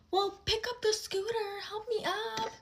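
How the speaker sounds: high whiny crying demand; bratty crying. Retold or imitated. Friend on the road ordering me to help, mock bratty crying. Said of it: "crying like a brat".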